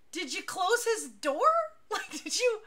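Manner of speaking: can't believe they left it open; incredulous. Retold or imitated.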